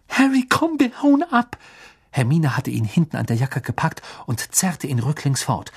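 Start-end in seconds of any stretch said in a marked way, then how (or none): 0.1-1.6 put-on urgent girl's voice. A narrator's reading. Hermine urges Harry to flee.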